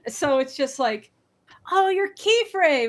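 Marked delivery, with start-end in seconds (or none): 1.7-2.9 surprised recognition. Retold or imitated. Fan recognizing speaker, retold.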